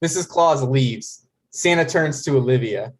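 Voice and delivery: ordinary delivery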